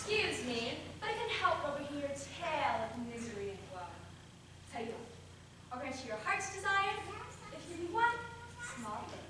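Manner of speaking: soft, hushed villain offer; sinister whisper. Acted. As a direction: lure them in quietly. Sinister stranger offering deal.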